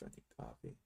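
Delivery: whispered aside; whispering. Real, not acted. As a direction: breathe it out under your breath.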